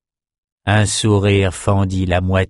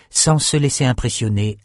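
ordinary delivery, nothing marked

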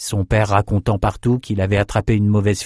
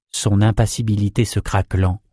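ordinary delivery, nothing marked

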